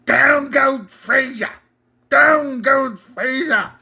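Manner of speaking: second try at a ringside announcer; lightly voiced Cosell, still weak; Howard Cosell impression. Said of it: "Still bad".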